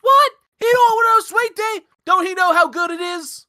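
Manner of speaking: hammed-up outrage; shocked diner cook. Husband voiced shouting back.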